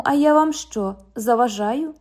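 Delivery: usual speaking voice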